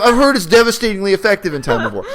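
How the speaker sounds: high, loud laughing mock pitch; sarcastic Daenerys. Mocking Daenerys's plan to the co-host, high, loud and laughing. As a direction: sell starvation with a grin.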